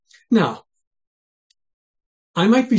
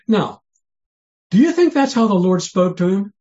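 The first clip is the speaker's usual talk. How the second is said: ordinary talk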